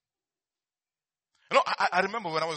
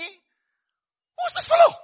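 high, slow and loud challenge; defiant cry; 13 dB louder, much slower than usual. Retold or imitated. David challenging the Philistine, a loud, slow, high cry. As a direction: hurl it across the battlefield. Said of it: "He says, David says".

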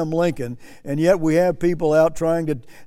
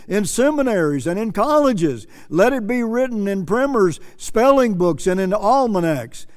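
rising, higher-pitched rhetorical list; preacher declaiming a quotation; 4 semitones higher than usual. Acted. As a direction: drive each phrase home like a preacher.

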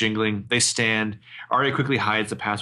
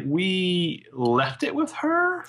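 slow, higher, unsure excuse; unsure lie; 8 semitones higher, much slower than usual. Retold or imitated. Arya covering for the missing paper, slow and unsure. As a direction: stretch the lie out, hoping it works. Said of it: "And Arya's like".

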